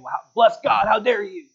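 loud, high, indignant outburst; mock angry retort. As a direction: bark it like an offended churchgoer. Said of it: "I'm not angry saying".

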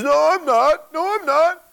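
high, loud panicky denial; frantic denial. Retold or imitated. Peter denies knowing Jesus.

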